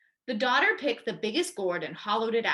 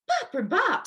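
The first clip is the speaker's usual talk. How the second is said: bouncing sound; 9 semitones higher, 4 dB louder than usual. A narrator's reading. Grandma bounced in gourd.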